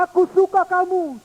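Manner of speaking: put-on high, loud child's voice; child calling out. Boy calling fondness to the hills, high child voice. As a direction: call it fondly to the hills.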